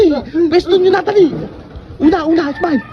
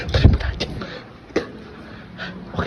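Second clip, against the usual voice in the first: whispering; 11 dB quieter, faster than usual. Real, not acted. Unvoiced near sleeping baby.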